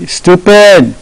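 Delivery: high-pitched mock call, drawn out; calling down a well. Boy leaning over an abandoned well, mock taunting call. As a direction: holler "Stupid" down into a well. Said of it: "leaning over in the well and say".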